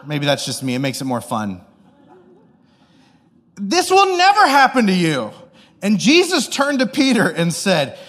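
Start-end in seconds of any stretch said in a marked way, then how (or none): 3.6-5.3 high, loud, emphatic protest; reprimanding. Acted. Peter takes Jesus aside, reprimanding him loudly. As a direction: scold with dramatic insistence. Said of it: "began to reprimand him".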